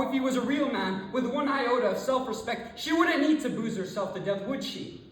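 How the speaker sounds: scornful rant, restrained yell; mild narrated scream. A narrator's reading. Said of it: "while she screams at him".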